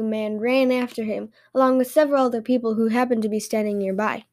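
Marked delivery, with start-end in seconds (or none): none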